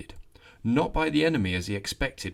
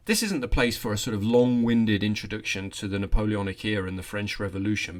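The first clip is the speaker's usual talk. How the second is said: ordinary talk, nothing marked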